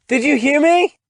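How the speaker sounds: high, loud shout; mother yelling. Acted. Mother yelling her daughter's name through the wall, high and loud. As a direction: yell up through the house at the daughter. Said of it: "Missus Carpenter yells again".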